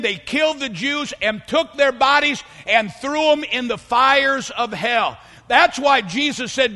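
ordinary delivery

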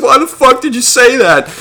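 high, mocking voice of a crying girl; mocking angry girl. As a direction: squeal it like an offended girl. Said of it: "they're just like".

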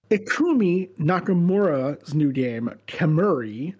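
slow, syllable by syllable; over enunciating. Shown as a demonstration. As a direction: spell each syllable out slowly. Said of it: "one syllable at a time".

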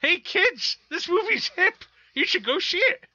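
put-on high, loud creaky old-timer; old man voice. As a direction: play a cranky old grandpa. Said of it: "like an old man saying it's hip".

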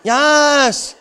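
high, stretched-out squeal; mock excited. Preacher mimicking Hallmark movie fans, over-excited. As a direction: imitate gushing fans, pitched right up. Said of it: "So many of you got excited".

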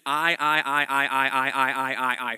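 loud repeated I, punched over and over; I I I I driven hard and loud; hammered repetition. Shown as a demonstration. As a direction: drum the word I again and again like a beat. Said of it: "first person pronoun all the way down".

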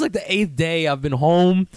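usual speaking voice

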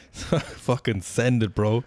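slow, drawn-out bro hype call; frat bro. Acted. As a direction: lay on the dude-bro swagger, slowly. Mock bro hype reply.

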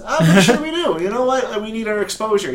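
hammed-up eager sponsor; mock company rep. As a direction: play a pushy sponsor begging for exposure.

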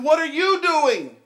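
high, loud, slow disbelieving yell; young guys yelling in shock after the crash; shocked shout. Retold or imitated. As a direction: cry out as your car gets rammed. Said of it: "And they said".

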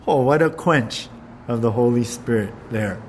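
ordinary talk

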